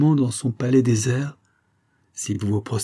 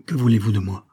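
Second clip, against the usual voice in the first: ordinary delivery, nothing marked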